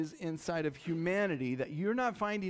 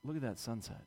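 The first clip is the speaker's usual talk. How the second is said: pretend sunset admiration; 5 semitones lower, 7 dB quieter than usual. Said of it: "pauses and says".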